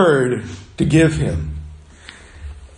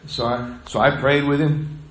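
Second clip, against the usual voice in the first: ordinary talk, nothing marked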